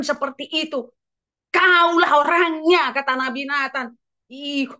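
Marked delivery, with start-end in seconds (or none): 1.5-2.9 loud, slow accusation; stern rebuke. Acted. Prophet Nathan condemns the king, loud slow rebuke. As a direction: point the finger, slow and loud.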